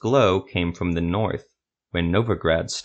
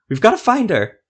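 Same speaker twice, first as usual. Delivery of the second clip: much higher, louder desperate plea; desperate cry; 13 semitones higher, 6 dB louder than usual. A narrator's reading. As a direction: cry out to go save her friend. Silva desperate about her friend.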